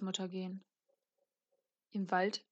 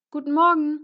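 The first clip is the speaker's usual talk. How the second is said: high, loud call into the house; raised, carrying hello; 7 semitones higher, 12 dB louder than usual. A narrator's reading. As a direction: call out a ringing morning greeting. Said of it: "rief das Rotkäppchen".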